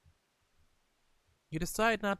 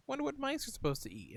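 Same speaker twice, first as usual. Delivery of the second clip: high quick musing; 10 semitones higher, 5 dB quieter, faster than usual. Real, not acted. Musing aloud.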